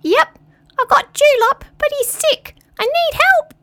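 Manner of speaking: very high tiny squeaky voice; small creature piping up; high squeak; squeaky crayfish. Acted. Crayfish character voice.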